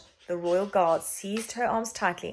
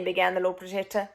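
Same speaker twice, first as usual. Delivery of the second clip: ordinary delivery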